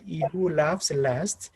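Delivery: "he who laughs last" lifting on "last"; rising intonation. Shown as a demonstration. Teacher giving the last example's preposition, rising intonation. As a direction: raise "last" at the end, as if unfinished. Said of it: "said with a rising intonation".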